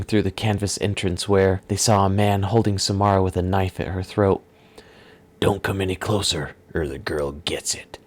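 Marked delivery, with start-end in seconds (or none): 5.4-8.0 gruff villain. Acted. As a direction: threaten quietly with a knife at her throat. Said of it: "at his gruff voice".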